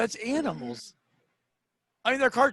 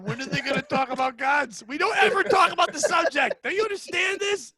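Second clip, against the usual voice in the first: loud, high-pitched mock indignation; outraged imagined viewer; 4 semitones higher, 5 dB louder than usual. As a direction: rant like a fed-up viewer, climbing higher. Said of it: "I hope someone's watching going".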